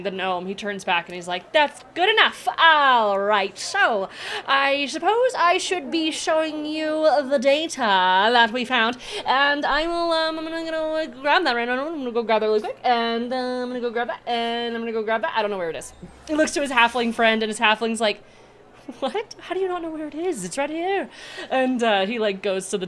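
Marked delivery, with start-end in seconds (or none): 1.5-15.9 high, louder gnome voice; fussy gnome. Acted. Gnome scientist showing visitors the data, high and fussy. 19.0-21.1 high, quick incredulous voice; can't believe he lost it; exasperated halfling. Acted. Halfling scolding her gnome friend, high and incredulous.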